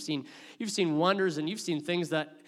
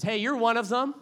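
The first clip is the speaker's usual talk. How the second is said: put-on accusing girl; 7 semitones higher, 7 dB louder than usual.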